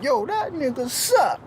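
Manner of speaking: mock trash talk. Voicing [name] trash talk.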